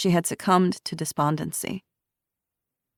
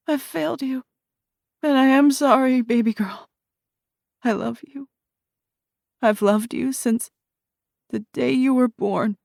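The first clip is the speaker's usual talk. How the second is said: higher, fragile goodbye; tearful farewell; 8 semitones higher than usual. A narrator's reading. Dying mother to her baby girl, tender farewell. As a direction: say goodbye through fading strength.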